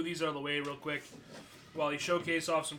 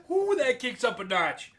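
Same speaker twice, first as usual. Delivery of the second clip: laughing; 7 semitones higher, 7 dB louder than usual. Real, not acted. Laughing through the burn.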